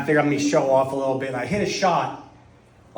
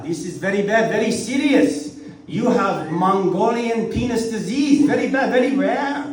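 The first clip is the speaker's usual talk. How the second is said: Chinese doctor; 5 semitones higher than usual. Retold or imitated. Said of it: "he says".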